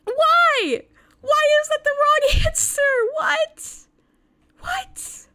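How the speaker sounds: squeaky high, drawn-out disbelieving whats; mock outrage, voice climbing into a squeak; incredulous what. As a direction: stretch every what up high in shock. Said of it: "You're like".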